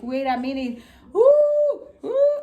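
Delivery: high loud pained ooh; retold labor scream. Mother in labor to husband, high pained ooh. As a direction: cry out through a contraction. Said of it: "I'm like".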